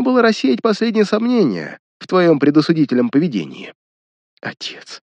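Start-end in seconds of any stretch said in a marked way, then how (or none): 4.4-5.0 тихий шёпот; прошептать едва слышно. Acted. Шёпотом зовёт отца. (шёпотом, почти без голоса)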